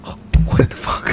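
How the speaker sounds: acting out breathless disbelief; mock shock at what was just heard; stunned reaction. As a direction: replay being blown away.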